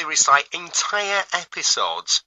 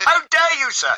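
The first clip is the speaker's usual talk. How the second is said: mock outrage; 11 semitones higher than usual. Mock outrage from insulted townsfolk.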